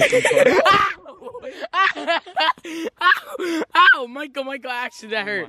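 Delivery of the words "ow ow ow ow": high repeated yelps of pain. Real, not acted. Friend just had a finger burnt, yelping. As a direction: yelp "ow" through laughter.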